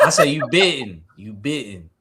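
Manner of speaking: thick New York accent, bitten for bidding. Real, not acted. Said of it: "I know my New York accent is strong".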